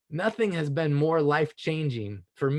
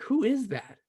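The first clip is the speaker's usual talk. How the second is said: surprised wonder; 8 semitones higher than usual. Retold or imitated. Seeing image form in water.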